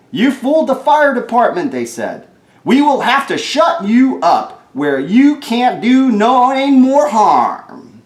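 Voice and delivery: high, loud scolding; scolding firemen. Acted. Firemen scold the naughty monkey, loud and high. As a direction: tell the monkey off sharply.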